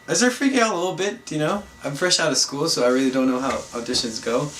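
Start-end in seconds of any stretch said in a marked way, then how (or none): none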